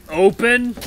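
high, loud one-word yell; impatient holler; demanding shout. Acted. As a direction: shout an order through the door. Intruder yells while kicking door.

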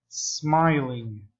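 "Smiling" begun straight on the s; correct pronunciation. Shown as a demonstration. English teacher models smiling for students, correct pronunciation. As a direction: model "Smiling" with no vowel before the S. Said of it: "que lo diga bien".

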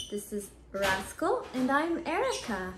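usual speaking voice

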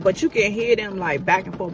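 ordinary delivery

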